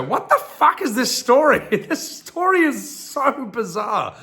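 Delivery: high, loud incredulous outburst through laughter; laughing disbelief. Real, not acted. Streamer reacting to a bizarre fairy tale, laughing disbelief.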